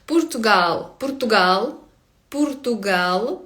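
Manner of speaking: Portuguese final-stressed "Португал"; stress on last syllable. Shown as a demonstration. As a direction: repeat "Португал", landing on GAL. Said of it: "наголос завжди на останній склад".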